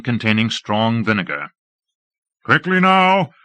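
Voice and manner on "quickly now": high, loud urgent call. A narrator's reading. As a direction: hurry the troops along. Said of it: "called Lord Fayed".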